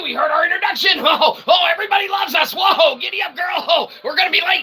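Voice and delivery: high, shouted hearty ho-ho host riding in on horseback; jolly showman entrance. Acted. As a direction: burst in like a booming ringmaster late for his own show.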